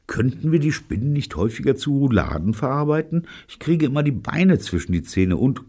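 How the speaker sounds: high, quick female character voice; complaining Jean. A narrator's reading. Jean complaining about spider legs in her teeth, high and quick. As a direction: grumble quickly in a high-pitched voice.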